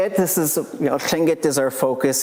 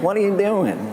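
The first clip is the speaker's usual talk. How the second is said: high, incredulous retort; high mock disbelief; 8 semitones higher than usual. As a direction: toss it back in disbelief. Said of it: "I'm like".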